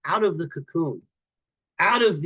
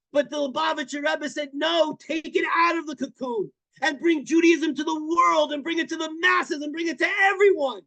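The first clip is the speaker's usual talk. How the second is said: impassioned preaching; 9 semitones higher than usual. Retold or imitated. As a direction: preach it like a fiery speech. Guest preaches the Rebbe's mission.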